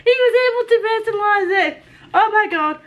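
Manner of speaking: high, thrilled squeal; overwhelmed squeal. Real, not acted. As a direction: squeal with disbelieving joy. Finds personalised book, overwhelmed.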